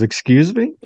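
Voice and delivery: high, taken-aback question; a fan who can't believe what he just heard; indignant excuse me. Retold or imitated. Fan told to leave the pits, high indignant disbelief. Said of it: "And the guy goes".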